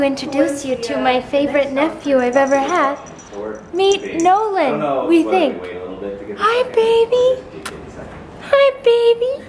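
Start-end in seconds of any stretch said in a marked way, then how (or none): 3.7-9.4 high, slow cooing at a puppy. Real, not acted. Greeting the new puppy.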